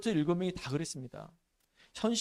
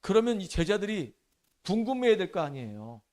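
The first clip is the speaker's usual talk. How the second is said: ordinary talk, nothing marked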